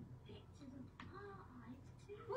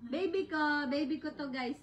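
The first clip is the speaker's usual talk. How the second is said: loud, proud presentation; proud showing off; 3 semitones higher, 9 dB louder than usual. Real, not acted. Showing the baby to the viewers, proud and loud. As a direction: show her off loudly.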